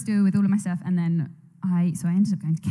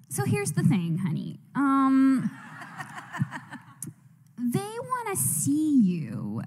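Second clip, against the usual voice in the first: manager breaking news; 4 semitones higher, much slower than usual. Retold or imitated. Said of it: "She was like".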